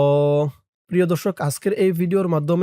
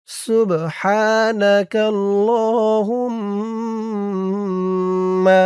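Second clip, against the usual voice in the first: drawn-out melodic Arabic recitation; slow, sung-out Arabic prayer recital; slow Quran recitation; 3 semitones higher, much slower than usual. Shown as a demonstration. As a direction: chant each word slowly, tajweed style. Teacher recites Arabic slowly.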